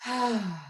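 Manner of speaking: short audible sigh falling away; demo sigh. Shown as a demonstration. Instructor shows a plain sigh before the silent one, audible sigh. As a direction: sigh like you're bored and over it. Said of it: "and just like you sigh".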